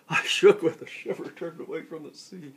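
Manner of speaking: high, quick burst, loud then dropping; dramatic alarm. A narrator's reading. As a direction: recite it as a startled recoil. Poem refrain at the climax.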